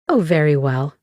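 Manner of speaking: plain reading voice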